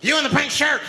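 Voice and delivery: high, commanding mock order; single someone out in a high voice; demanding madman. Homeless man picking out a passenger, high demanding call. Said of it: "he starts making demands".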